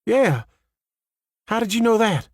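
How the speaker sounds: a hint of shocked disbelief; surprised. Sheriff startled by his guess.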